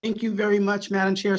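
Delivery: ordinary delivery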